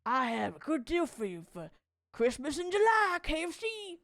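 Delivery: imitating the Colonel. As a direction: ham up a jolly old Colonel pitching a deal. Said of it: "I assume he sounds like this".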